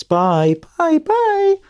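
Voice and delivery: sing-song goodbye that soars up at the end; phone call goodbye. Shown as a demonstration. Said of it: "how we say goodbye at the end of phone calls".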